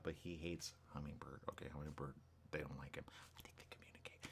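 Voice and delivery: hushed conspiratorial aside; very soft, under the breath; secretive whisper. Pretend. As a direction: whisper it as if they might overhear. Conspiratorial aside about hummingbirds.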